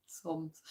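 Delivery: quiet "Psalms" with a soft open "ah"; trying a new way. Shown as a demonstration. Vlogger testing a new pronunciation, quiet. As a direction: say "Psalms" quietly, vowel as "sahms". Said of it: "doesn't feel right saying it like that".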